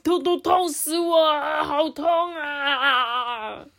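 high, drawn-out mock wailing ending in a laugh; hammed-up pain. Toad being pulled too hard, mock wailing in pain. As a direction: overplay the toad's ouch.

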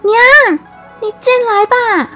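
high loud call to mother; shouting outward. A narrator's reading. 葫芦 calls her mother in from outside, shouting. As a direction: call out loudly through the door. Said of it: "朝外面大喊".

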